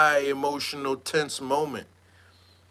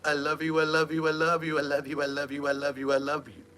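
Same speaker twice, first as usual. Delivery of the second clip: a lightly voiced soft, dreamy repeat; Nature Boy's plea; 5 dB quieter, faster than usual. Mocking the man's soft plea to the cops. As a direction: softly coo it over and over. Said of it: "Nature Boy said".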